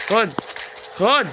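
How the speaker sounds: sharp shout. Real, not acted. Hitting an enemy in game.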